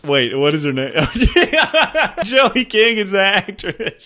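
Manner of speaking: high, loud laughter through the words; laughing loudly. Real, not acted. Laughing at his own mix-up, loud and high. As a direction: crack up while asking. Laughing fit over a name.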